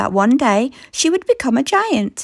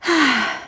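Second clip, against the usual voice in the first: weary sigh. Acted. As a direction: let out a little sigh. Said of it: "Ellie sighed".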